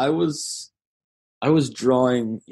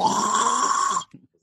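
zombie. Pretend. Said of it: "would you be willing to give us a zombie sound".

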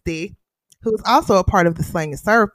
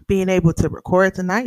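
ordinary delivery

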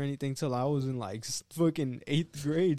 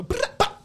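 very high loud trilled brrr; rolled r; 8 dB louder than usual. Shown as a demonstration. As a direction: squeal out a rolled r. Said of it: "we're gonna stop doing that".

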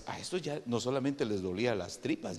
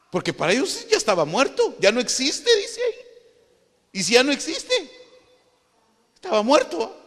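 high, loud challenging questions; loud incredulous exclamation; 11 dB louder than usual. Real, not acted. Preacher challenges the brothers' claim of a death, high and loud. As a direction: cry it out as if unbelievable.

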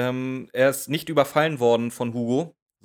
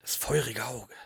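leise, geheimnisvoll gehauchte Betonung auf feurige Auge; geheimnisvoller Sprecher; 7 dB quieter than usual. Retold or imitated. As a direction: wie ein Hörspielsprecher, der ein Geheimnis andeutet. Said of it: "der macht das so". (leise und geheimnisvoll nachgemacht)

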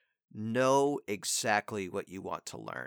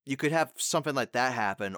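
ordinary delivery

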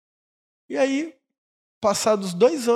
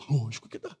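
softer, lower repeat; soft echo; 6 semitones lower, 7 dB quieter than usual. Shown as a demonstration. Pastor repeats the answer, soft and low. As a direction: murmur it again in agreement.

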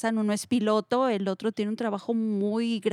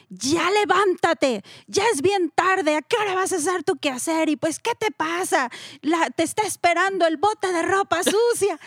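nagging mom; 6 semitones higher than usual. Retold or imitated. Mom yanking her daughter out of bed, higher scolding nag. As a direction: rouse the lazy kid with a scolding. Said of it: "pero es de".

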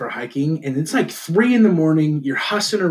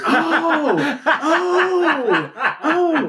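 high, drawn-out mock realization; imitated knowing reaction; 12 semitones higher, much slower than usual. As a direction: play the seatmate's exaggerated "ah, I get it". Imitating drinker's reaction to Utah.

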